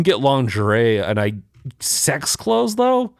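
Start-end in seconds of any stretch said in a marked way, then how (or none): 1.8-3.1 slow, much higher disbelieving question; drawn out as if it can't be real; incredulous. Real, not acted. Repeating the odd phrase.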